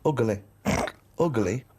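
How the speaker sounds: Ugly said with extra syllable, "ug-uh-ly"; mimicking ugly. Shown as a demonstration. Mimicking Carl's three-syllable Ugly to tease him. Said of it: "extra syllable in the word ugly".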